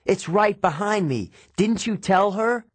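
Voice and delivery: high-pitched urgent cry, little extra volume; yelled. A narrator's reading. Grover warns of what is behind him, mild yell. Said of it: "he yelled".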